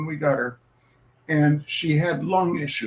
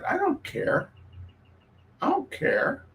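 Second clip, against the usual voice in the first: much higher, soft, bored cat voice; indifferent cat; 11 semitones higher, 4 dB quieter than usual. Pretend. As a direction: play the haughty old cat brushing her off. Cat answers owner in voiced quote.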